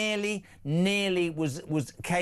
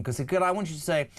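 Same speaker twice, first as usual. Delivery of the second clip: usual speaking voice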